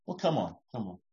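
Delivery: soft, gentle coaxing; soothing parent. Pretend. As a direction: comfort the child quietly.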